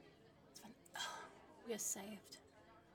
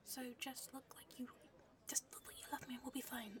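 soft, hurried whispered confession; nervous agent whispering plans; urgent whisper; 9 dB quieter than usual. Acted. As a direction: keep it under the cover talk. Confessing blown cover in whisper.

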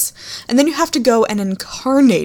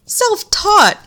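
mock whiny voice; 11 semitones higher, 4 dB louder than usual. Mocking warlock's claim.

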